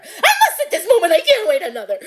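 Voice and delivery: high, loud, laughing imitation of Mary's cry; mock labor cry. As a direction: echo her desperate cry, half laughing.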